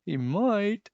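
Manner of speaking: squeaky squirrel yelp. A narrator's reading. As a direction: agree in a high little yelp. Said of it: "yelped the squirrel".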